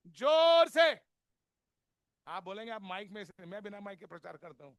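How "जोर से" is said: very loud, slow, high bellow; full shout. Real, not acted. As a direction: roar it out to show how loud. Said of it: "कैसे बजाना है?". (बहुत ज़ोर से धीमी चीख)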